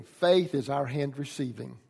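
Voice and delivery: usual speaking voice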